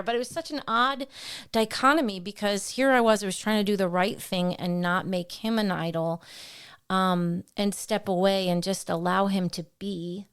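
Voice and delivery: ordinary delivery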